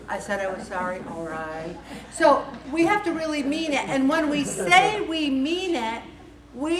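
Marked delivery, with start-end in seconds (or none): 0.1-1.8 subdued, lower mock husband apology; a hint of sulky husband, softer at the end. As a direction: lightly imitate a grudging husband, low. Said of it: "we call it our husband voice".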